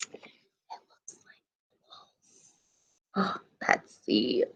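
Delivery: unvoiced suspense whisper. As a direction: whisper the secret to the children. Reader whispers suspense to kids.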